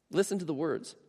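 usual speaking voice

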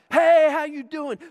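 big high-pitched showman voice; hammed-up athlete working a crowd; imitated gladiator athlete. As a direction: play the over-pumped rally star. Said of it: "he talked like this".

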